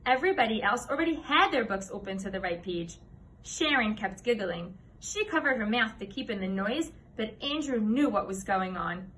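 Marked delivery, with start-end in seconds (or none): none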